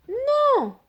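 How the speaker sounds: very high little cake voice; a tiny high refusal; squeaky no. A narrator's reading. Cake refusing the rabbit, squeaky high no.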